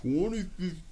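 high-pitched, dazed stoned disbelief; strained, high-pitched baffled echo; imitating stoned self. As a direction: play someone far too high repeating the total. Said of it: "going super slow".

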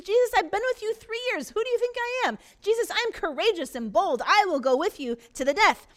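incredulous boasting. Acted. Peter to Jesus, high incredulous boasting. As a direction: overcompensate in disbelief. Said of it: "He couldn't even comprehend".

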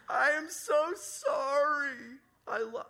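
high, loud, very slow choked-up plea; imitating a weepy, heartfelt sorry; tearful apology. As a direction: voice the neighbor crying as she apologizes.

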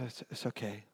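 very soft, low reassurance; quiet forgiveness. Retold or imitated. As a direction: gently let the kid off, as dad. Dad forgives the guilty son.

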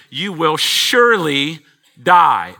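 "surely" stretched, slow and loud; stress on surely. Shown as a demonstration. Preacher stressing the warning "surely", slow and heavy. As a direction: lean hard on "surely". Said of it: "Again, emphatic".

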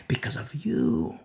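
"Because of you" dropped low and soft; low soft answer. Real, not acted. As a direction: let "Because of you" fall quietly. First take of repeated answer.

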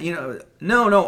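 ordinary talk, nothing marked

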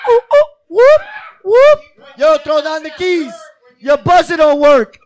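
high whoops then loud call; shouting up to balcony. Shown as a demonstration. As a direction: call up through the window. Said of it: "call a friend through a window".

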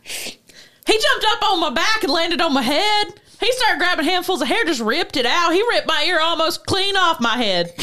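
put-on friend asked to read the quote in an Oklahoma accent. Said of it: "do the quote in that accent".

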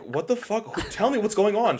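high-pitched imitation of panicked demand; retold freak-out. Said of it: "started freaking the fuck out".

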